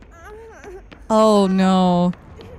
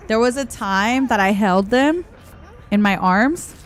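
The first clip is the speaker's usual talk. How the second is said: ordinary talk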